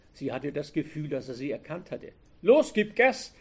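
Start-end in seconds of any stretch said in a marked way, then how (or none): 2.4-3.3 sharp order, much higher and louder. A narrator's reading. Robber ordering Anke to drive, sharp command. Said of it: "befahl der Mann".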